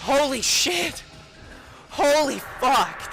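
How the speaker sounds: loud, high, slow exclaiming; shocked exclaiming. Real, not acted. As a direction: gasp it out in shock. Relief after chase.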